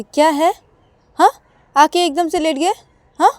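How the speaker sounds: high, loud indignant questions; annoyed confronting. Acted. Payal stands over Ananya who lay down, annoyed confronting.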